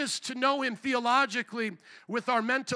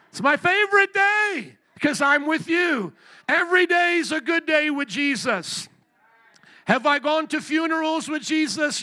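loud, higher preacher's cry, hammering the point; preaching shout; 5 semitones higher, 5 dB louder than usual. Real, not acted. Preacher shouting the joy of Jesus to his church, full-force shout. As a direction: belt it out to the whole congregation.

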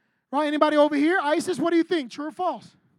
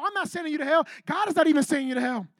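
normal talking voice

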